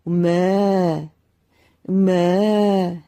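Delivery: slow, drawn-out loud mooing; a man trapped in a cow's body, mooing. A narrator's reading. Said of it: "여전히 소의 울음소리뿐이었습니다".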